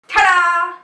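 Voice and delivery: loud, high ta-da; playful magician-style flourish, high and loud; mock grand reveal. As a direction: belt out a showy fanfare.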